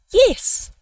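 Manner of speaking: ordinary delivery, nothing marked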